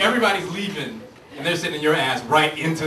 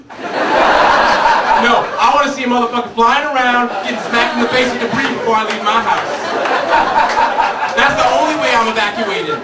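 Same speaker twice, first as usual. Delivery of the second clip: loud, exasperated rant; 4 semitones higher, 8 dB louder than usual. Real, not acted. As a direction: shout it out, fed up. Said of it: "ranting about evacuation".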